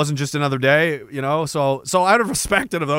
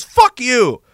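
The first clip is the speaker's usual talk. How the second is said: high, loud retort; imitated defiant shout; 6 semitones higher, 5 dB louder than usual. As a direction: hurl it back like a defiant soldier. Acting out defiant soldier's reply.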